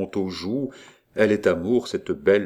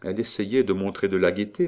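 ordinary delivery, nothing marked

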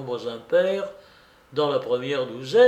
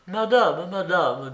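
honeyed, slightly raised pleading voice; doucereux; 5 semitones higher, slower than usual. A narrator's reading. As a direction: coax her with a sugary, mild insistence. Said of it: "dit d'une voix doucereuse". (voix doucereuse)

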